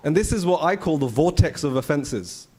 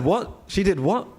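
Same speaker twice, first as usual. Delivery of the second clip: incredulous, higher rising questions; shocked disbelief; 5 semitones higher, slower than usual. Retold or imitated. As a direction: act out disbelief at hearsay. Retelling own shocked reaction to gossip.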